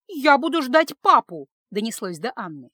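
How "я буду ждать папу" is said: put-on loud stubborn child call; shouting. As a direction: yell it from another room. Girl shouts from bathroom.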